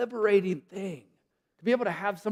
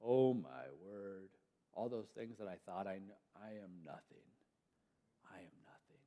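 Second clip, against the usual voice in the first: much softer, lower, fading to a whisper; hushed awe before an angel; awestruck; 8 semitones lower, 18 dB quieter than usual. Retold or imitated. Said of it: "it makes us say".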